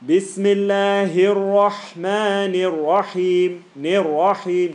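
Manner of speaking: slow and loud Bismillahir Rahmanir Rahim; loud recitation. Shown as a demonstration. Teacher shows the student how loud to recite. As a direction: project every syllable, slowly. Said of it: "Yeah, loud, bro".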